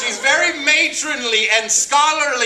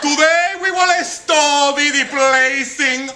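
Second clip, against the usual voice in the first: Mrs. Martinez the teacher; 6 semitones higher than usual. Acted. Acts out teacher addressing the class.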